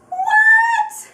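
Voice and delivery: very high, loud squealed exclamation; disbelieving squeal. Acted. Fan boasting of a selfie with Tim, giddy squeal. Bragging about selfie with idol.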